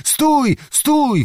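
high-pitched call to halt. A narrator's reading. Voices on both sides halt a wedding party, calling out. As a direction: call it out high, each word falling. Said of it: "wołano z obu stron".

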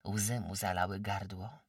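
ordinary delivery, nothing marked

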